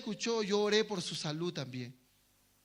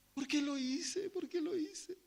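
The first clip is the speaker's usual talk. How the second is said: higher, softer regretful whimper; mock weeping; 7 semitones higher, 5 dB quieter than usual. Preacher acting the sinner still weeping in regret, soft whimper. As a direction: lament softly to yourself. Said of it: "Está llorando".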